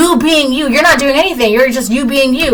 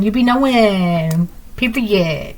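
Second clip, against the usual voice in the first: ordinary talk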